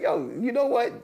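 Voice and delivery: high, loud mock realization; amused exclamation. Reacting to his man's saying, high amused exclamation. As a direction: voice jumps up, drawing out the punchline.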